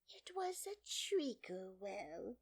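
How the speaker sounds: high, slow, whispery dormouse voice; half-asleep little creature; sleepy Dormouse. A narrator's reading. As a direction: tell it drowsily in a feeble high voice.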